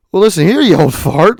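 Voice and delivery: mock indignation. Answering the bus driver's jab, mock indignant.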